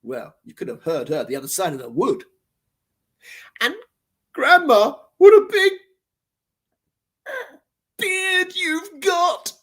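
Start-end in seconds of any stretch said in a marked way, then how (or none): 3.6-9.5 high, loud, then slow drawn-out sneer; mock the beard cruelly; nasty brat taunt. The brat jeering at Grandma's beard. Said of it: "laughed the brat nastily".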